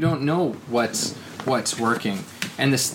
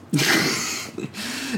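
laughing; 11 semitones higher, 3 dB quieter than usual. Real, not acted. Laughs mocking happy endings.

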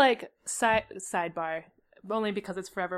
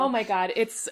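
ordinary talk

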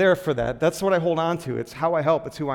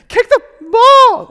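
very high, loud, drawn-out scream; imitated kids' yelling; 15 dB louder, slower than usual. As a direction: mimic kids shrieking orders on the pitch. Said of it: "thing I heard from all of the kids".

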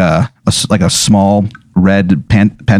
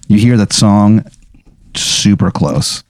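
ordinary delivery

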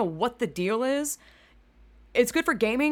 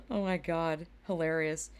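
ordinary delivery

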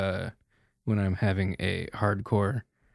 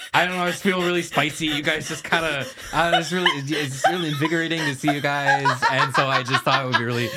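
laughing; 4 semitones higher, 5 dB louder than usual. Acted. Giddy laughing outburst.

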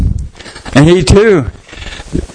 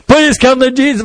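earnest plea; 7 semitones higher than usual. Acted. Couple pleading with crowd.

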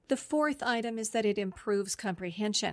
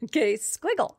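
squiggle said higher, louder and playful, savouring the word; playful fun word; 4 semitones higher, 5 dB louder than usual. Shown as a demonstration. Therapist showing kids a fun word, playful and higher. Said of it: "kind of a fun word to say".

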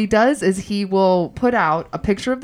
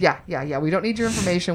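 ordinary talk

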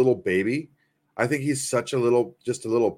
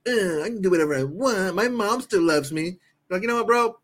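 mock bratty Travis; 8 semitones higher than usual. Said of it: "he's gonna be like".

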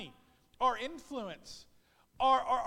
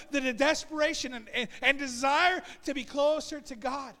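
loud, higher exhortation; rally the church to wake up; shouting sermon call to action; preaching at full force; 3 semitones higher, 7 dB louder than usual. Acted.

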